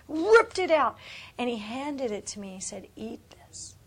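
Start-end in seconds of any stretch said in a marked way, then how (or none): 0.1-1.0 1 emphatic burst. Real, not acted. Dramatic vision moment. 3.0-3.8 hushed unvoiced awe; whispering in awe of the vision; awed whisper. God's words whispered.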